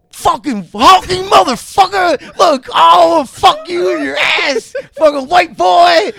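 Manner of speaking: high, very loud heckling shout; yelling inmates. Retold or imitated. Extras heckling Hugh Grant in the jail hallway, full furious shout. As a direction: scream abuse like a rowdy jailful of inmates. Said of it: "they're yelling it to".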